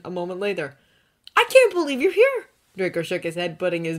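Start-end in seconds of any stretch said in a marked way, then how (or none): 1.4-2.5 high, loud delighted exclamation; overjoyed. A narrator's reading. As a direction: burst out happily. Draco overjoyed at Victor.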